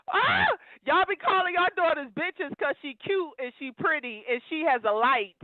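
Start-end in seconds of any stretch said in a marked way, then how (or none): 0.1-0.5 a high shrieking burst of laughter. Real, not acted. Laughing at her own roast, she shrieks out loud. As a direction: let out a loud squealing laugh.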